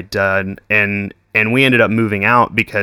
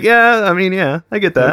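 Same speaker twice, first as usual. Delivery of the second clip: sarcastic agreement; 9 semitones higher than usual. Retold or imitated. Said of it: "I'm like".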